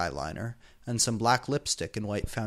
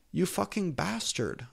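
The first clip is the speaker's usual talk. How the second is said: mild moan; 7 semitones higher than usual. A narrator's reading. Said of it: "I moaned".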